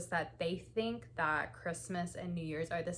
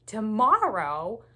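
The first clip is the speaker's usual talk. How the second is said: slow, much louder rising disbelief; shocked question; 4 semitones higher, 12 dB louder, much slower than usual. Retold or imitated. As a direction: stretch it out in loud disbelief. Husband shocked Christmas is tomorrow.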